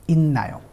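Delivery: इन्नायो with a doubled N replacing T; nasalized pronunciation. Shown as a demonstration. Teacher models the correct इन्नायो. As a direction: say इन्नायो, a long N. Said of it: "इसको हम पढ़ेंगे इन्नायो".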